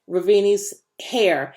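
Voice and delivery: plain reading voice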